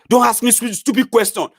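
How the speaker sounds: high, loud irritated retort; mock snap. Cranky Baba snapping at a question, high mock retort. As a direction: snap back like a cranky elder. Commenter snaps.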